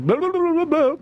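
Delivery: high mock foreign gibberish; imitating words you can't understand. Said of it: "in Eddie's language".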